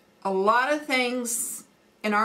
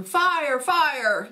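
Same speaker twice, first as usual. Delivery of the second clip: mild mock yell, higher pitch; a hint of a frightened shout; retold yell; 6 semitones higher than usual. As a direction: echo the girls' alarmed cry. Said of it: "both of my girls were yelling".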